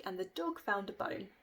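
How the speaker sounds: ordinary delivery, nothing marked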